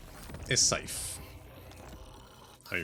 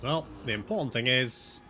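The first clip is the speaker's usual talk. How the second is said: ordinary talk, nothing marked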